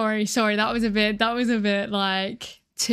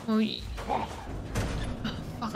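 nervous whisper; 9 dB quieter, much slower than usual. Real, not acted. Dreading the basement.

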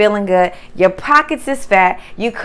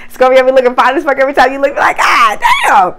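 louder, hyped admiring rush; excited admiration; 7 dB louder than usual. Real, not acted. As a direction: gush over how fine Scorpio looks. Admiring Scorpio's looks.

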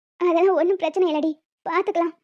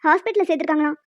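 ordinary delivery

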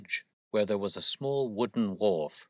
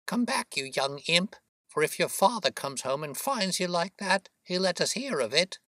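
high, scolding mother's call; shouted scolding; 11 semitones higher than usual. A narrator's reading. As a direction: scold a runaway child in a high voice. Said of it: "she shouted".

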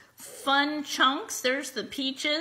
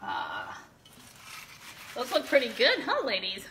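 ordinary delivery

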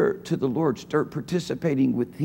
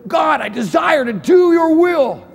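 loud, high cry to God; shouted prayer; 11 semitones higher, 8 dB louder, slower than usual. Pretend. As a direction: pray it out with full force. Voicing a prayer of desire.

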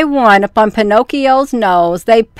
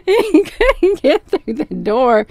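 slow, laughing through the repeat; 4 semitones higher, 3 dB quieter than usual. Real, not acted. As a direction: say it again, still cracking up. Repeats line, still laughing.

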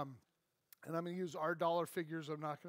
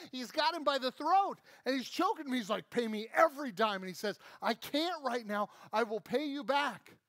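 heated choking scene; 7 semitones higher, 6 dB louder than usual. Acted. Choking scene acted loud.

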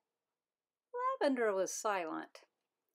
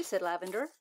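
plain reading voice

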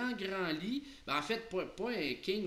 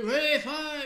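put-on loud, high bellow of a cranky old lord; mock tantrum yell; hammed-up howl; 11 semitones higher, 11 dB louder than usual. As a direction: holler it at full blast, pitch way up. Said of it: "il se met à hurler".

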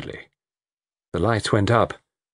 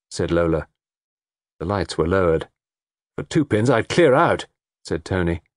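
ordinary delivery, nothing marked

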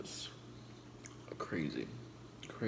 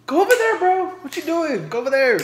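very loud, much higher yell; yelling at dog; 17 dB louder, faster than usual. Real, not acted. Vlogger yells at her dog to go over there. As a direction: scream the command across the room.

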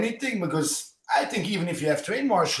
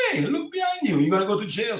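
higher-pitched, urgent warning; urgent warning call; 6 semitones higher than usual. Retold or imitated. To the knife-wielding driver, a retold urgent high warning. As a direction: call it out sharply over his shoulder, imitating. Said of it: "I'm like".

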